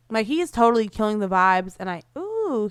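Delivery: normal talking voice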